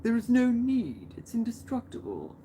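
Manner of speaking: slow, theatrical villain decree; hammed-up sinister boss giving orders. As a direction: lay it out slowly like a grand schemer. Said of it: "And maybe they're like".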